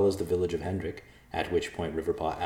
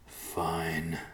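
grumbling; 6 dB quieter than usual. Acted. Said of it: "Riverpaw grumbled".

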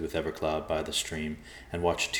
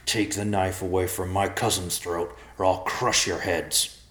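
slowing, menacing bear voice; threatening bear; 2 semitones higher than usual. A narrator's reading. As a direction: warn them off as a protective bear. Bear threatens men holding knife.

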